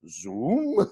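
drawn-out Welsh "Zoom"; Welsh accent. Pretend. Friend describing lecturers' online classes, Welsh accent. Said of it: "why I went to a Welsh accent".

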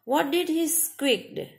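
ordinary talk, nothing marked

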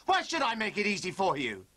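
ordinary talk, nothing marked

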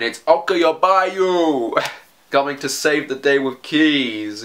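big drawn-out hero entrance with a laugh; mock-heroic announcement. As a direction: announce the hero with playful bombast.

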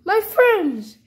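high, loud mock dismay; alarmed cry. Character finds the friends hurt, high alarmed cry.

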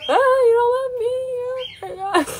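high, loud mock wail; crying child imitation. Mother mimicking her daughter's overreaction, mock wailing. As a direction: wail like an upset little kid, hammed up. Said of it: "And she took that as".

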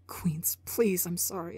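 breathless whispered apology; desperate whisper. Acted. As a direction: whisper it like a broken plea.

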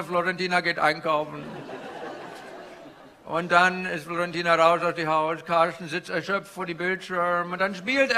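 put-on comic Indian-accented stand-up guru.

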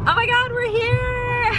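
high, slow thrilled exclamation; drawn-out delighted "we're here"; excited arrival. Real, not acted. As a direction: gasp it out, delighted to finally arrive. Arriving at the iconic bridge.

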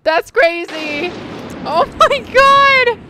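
high, loud, drawn-out shocked cry; shocked shriek. Real, not acted. Player shrieking that her Sim is pregnant by his father. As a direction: shriek in disbelief.

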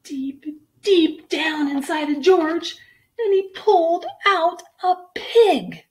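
high, loud, punched storytelling; excited narration. A narrator's reading. Storyteller revealing what the vet pulled out, excited build-up. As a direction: build to a big comic reveal.

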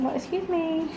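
high, soft mock indignation; voicing the sister. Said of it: "She said".

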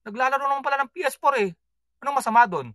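high, quick, dismissive retort; husband mockingly defending the kids' gaming; mock casual reply. Retold reply to wife, mocking.

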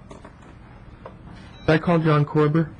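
ordinary delivery

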